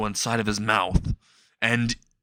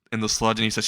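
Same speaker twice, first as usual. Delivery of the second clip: ordinary delivery, nothing marked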